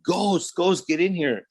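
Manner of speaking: high urgent call for a friend. Retold or imitated. Calling friend for help.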